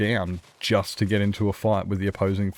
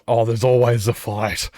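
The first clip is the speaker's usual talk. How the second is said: louder, casual quote of a friend; matter-of-fact friend; 2 semitones higher, 5 dB louder than usual. Retold or imitated. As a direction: toss it off like it's obvious. Friend quoted about going for fights.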